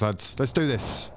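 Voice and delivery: usual speaking voice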